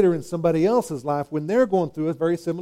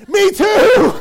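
much higher and louder, laughing; excited agreement; 8 dB louder than usual. Acted. Preacher playing a parent who also yelled at his kids, laughing. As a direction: blurt it out delighted. Mock reply to stammering friend.

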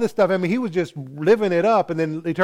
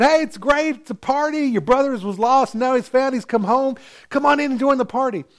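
cheery invitation; 10 semitones higher than usual. Acted. Servants invite brother to party.

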